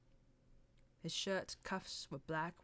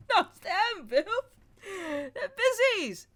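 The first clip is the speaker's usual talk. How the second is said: loud, higher words through laughter, slow at the end; laughing; 14 semitones higher, 15 dB louder, much slower than usual. A narrator's reading. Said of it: "The joke appealed to him so much".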